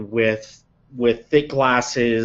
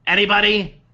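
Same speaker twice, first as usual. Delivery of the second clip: calling out to wake someone; 8 semitones higher than usual. Acted. Orc woman calling to wake Jed.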